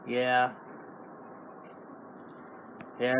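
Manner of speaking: ordinary delivery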